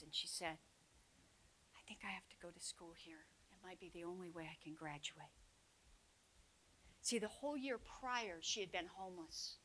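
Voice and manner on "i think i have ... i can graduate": barely audible whisper, a bit rushed; quiet retold voice. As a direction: whisper it shyly, eyes down. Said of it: "She was a really quiet voice".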